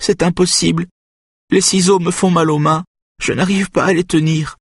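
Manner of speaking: high-pitched tearful protest; plainte reniflante. A narrator's reading. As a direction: whine it out, close to tears. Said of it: "renifle-t-elle". (protestation plaintive, voix aiguë)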